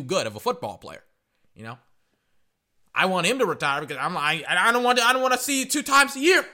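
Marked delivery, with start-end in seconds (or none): 3.0-6.4 exasperated outburst. Real, not acted. Complaining about rival player.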